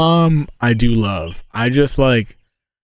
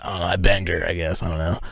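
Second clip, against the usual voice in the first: mumbled denial; 5 semitones lower, 7 dB quieter than usual. Retold or imitated. Denying mom to classmates.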